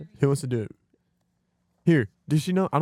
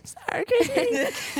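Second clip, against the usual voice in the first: imitating a pitched-up voice changer squeak, slow; high squeak; 3 dB quieter, much slower than usual. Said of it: "the high squeak".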